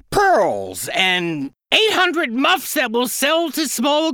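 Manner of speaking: a greedy duck bragging to the cat, high and excited. Acted. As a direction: list the riches like a duck drunk on greed. Duck's greedy wish list.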